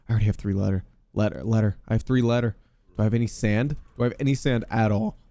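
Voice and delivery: ordinary delivery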